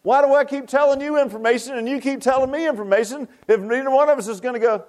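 usual speaking voice

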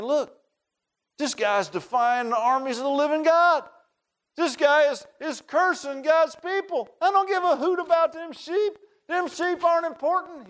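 put-on high, fired-up boy's voice; boy dismissing the sheep for God's fight; young David. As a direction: brush the brother off boldly. David rebukes his brother.

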